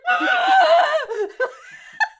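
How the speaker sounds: high, loud hammed-up scream; big pretend shriek; mock hostage scream. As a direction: scream like a hostage found in a car boot. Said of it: "She's going".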